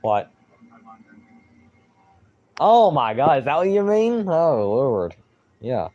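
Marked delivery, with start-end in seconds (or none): 2.6-5.1 loud disbelieving gasp of surprise; surprised. Real, not acted. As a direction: caught off guard, voice jumps up. Reacting to a chat comment.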